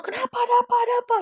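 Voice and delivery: very high imitated shout; retold panicked yell. Rider yelling to friend while speeding, high panicked mock shout. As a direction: cry out questions in mock panic. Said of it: "ikutan ngegas tapi sambil teriak juga".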